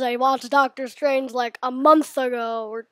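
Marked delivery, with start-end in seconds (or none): none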